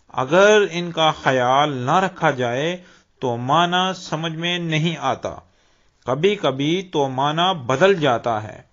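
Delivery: ordinary talk, nothing marked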